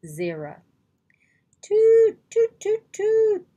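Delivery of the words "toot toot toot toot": high loud trumpet blasts, toot toot. A narrator's reading. Narrator imitates morning trumpets, high loud trumpet toots. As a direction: blare it like brass trumpets. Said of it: "The sound of trumpets filled the air".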